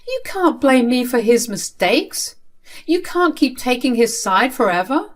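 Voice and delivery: high-pitched, insistent objection; upset protest. Acted. Child to father siding with brother, upset protest.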